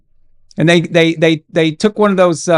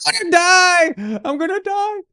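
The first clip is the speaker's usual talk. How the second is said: put-on panicked kid; 14 semitones higher than usual. Bleeding boy in the car to his mom, much higher panicked wail. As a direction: play a little boy sure he's dying. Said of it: "I'm just like".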